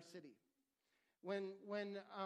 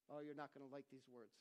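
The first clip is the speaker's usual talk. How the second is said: quiet teasing aside; 8 semitones lower, 11 dB quieter than usual. Real, not acted. Aside to congregation.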